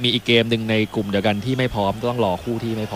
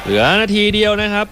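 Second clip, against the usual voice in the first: final-minute alert, higher and louder; urgent call; 9 semitones higher, 4 dB louder than usual. Real, not acted. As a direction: announce the last minute with urgency. Announcing final minute of match.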